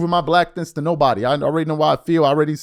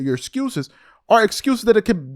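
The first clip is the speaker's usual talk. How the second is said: usual speaking voice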